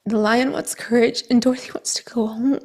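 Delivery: near tears, almost whispering the words; holding back tears, barely voiced; choked up whisper. Real, not acted. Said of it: "so emotional".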